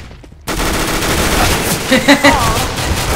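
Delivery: breathy burst of laughter; laughing. Real, not acted. Laughing after teasing.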